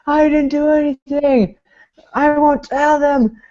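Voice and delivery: high-pitched mock pleading; mock panicked protest. Prisoner in solitary refusing to talk, mock panicked protest. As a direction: protest in an exaggerated high voice, drawn out at the end.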